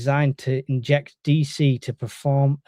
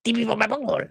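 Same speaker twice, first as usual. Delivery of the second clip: much higher cartoon voice; Cornholio impression; 8 semitones higher, 4 dB quieter, faster than usual. Showing his cohost the Beavis and Butthead bit, high cartoon squeal. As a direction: squeal it out like a hyper cartoon teen. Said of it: "have you not seen Beavis and Butthead?".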